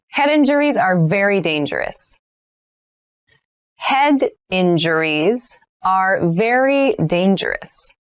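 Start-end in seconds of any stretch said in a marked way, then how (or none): 3.8-7.9 slow, careful sentence for learners; slow model read. Shown as a demonstration. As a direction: lay each word out slowly. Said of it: "teacher models sentence, third take".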